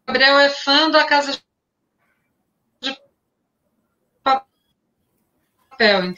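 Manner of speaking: choppy broken-up audio; words cutting out on a bad connection; buffering stutter. Real, not acted. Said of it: "Tá picotando".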